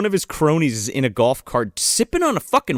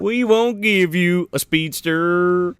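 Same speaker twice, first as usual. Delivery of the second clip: mock movie voice; slower than usual. Quoting a line in mock voice.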